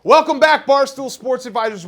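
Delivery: high, loud host welcome; bright show opener; announcer voice. Acted.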